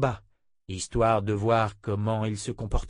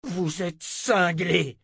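angry retort; 7 semitones higher, much slower than usual. Acted. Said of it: "lâcha Richard".

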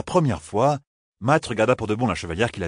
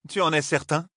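a lightly higher, doubtful queen; queen's voice; 8 semitones higher than usual. Acted. The queen doubts Matt's accusation, lightly higher queen voice. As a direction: ask it as the cool queen, a touch higher.

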